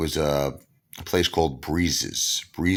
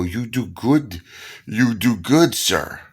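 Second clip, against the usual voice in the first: casino hustler; 6 semitones higher, slower than usual. Retold or imitated. Casino guy Pablo voiced.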